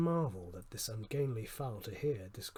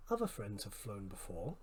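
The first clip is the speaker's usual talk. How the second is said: softer muttering to himself; muttered; 4 semitones lower, 6 dB quieter than usual. A narrator's reading. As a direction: murmur it almost under the breath. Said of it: "scarcely more than muttered".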